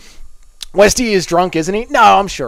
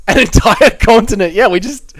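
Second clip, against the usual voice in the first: laughing in disbelief; 7 semitones higher, 4 dB louder than usual. Real, not acted.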